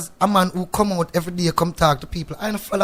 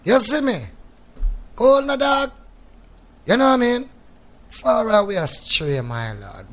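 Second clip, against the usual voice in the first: elder Jamaican youth; 5 semitones higher than usual. Retold or imitated. Showing an interviewer how the elders used to talk, imitated elder voice. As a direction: imitate the old-time elders, pitched up and emphatic. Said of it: "used to talk like this".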